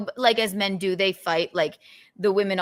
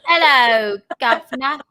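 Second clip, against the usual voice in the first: loud, higher mock-Cockney greeting; Cockney accent; 5 semitones higher, 9 dB louder than usual. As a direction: hail someone in hammed-up Cockney. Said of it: "your Cockney accent".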